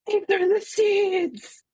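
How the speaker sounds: high, drawn-out excited cry; hyped up. Acted. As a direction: squeal it slowly with over-the-top hype. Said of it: "all hype about this".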